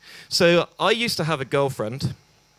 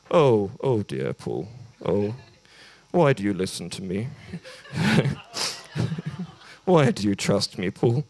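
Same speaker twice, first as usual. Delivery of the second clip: mock pity; 4 semitones lower than usual. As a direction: act ruefully sorry for the poor fool. Acted pitying reply after harsh review.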